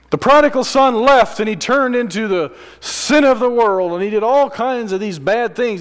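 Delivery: high, loud preaching at full force; fiery preaching. Acted. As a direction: preach it hard to the congregation. Preaches the story with rising force.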